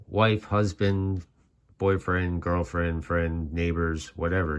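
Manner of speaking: normal talking voice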